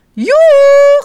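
een uitgelaten hoge gil, veel harder; gleeful yell. Acted. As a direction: gillen van plezier terwijl je wegrent. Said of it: "gilt Jip". (hoge jubelende gil)

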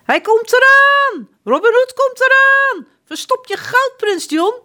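very high, loud panicked cry; shouting alarm. A narrator's reading. Disguised baker shouting the alarm to prince John, very high and loud. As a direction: scream the warning. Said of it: "roept de bakker".

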